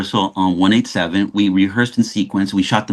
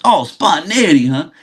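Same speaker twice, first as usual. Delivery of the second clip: higher mock-teasing imitation of Sam; teasing Samuel; 8 semitones higher than usual. Samuel ribbing the young actor about spontaneity, high and teasing. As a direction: poke fun at the young actor's word.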